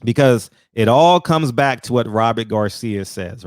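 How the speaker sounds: normal talking voice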